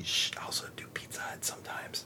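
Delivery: whispered aside; secret whisper. Real, not acted. Voice actor confessing he works for both brands, whispered.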